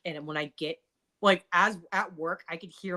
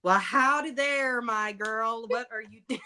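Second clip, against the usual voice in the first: slow, hammed-up Southern drawl on howdy; Southern accent; 5 semitones higher, 4 dB louder than usual. Asked to speak Southern, a lightly hammed Southern accent. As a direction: put on a cheesy Southern accent for fun. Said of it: "They only speak Southern now".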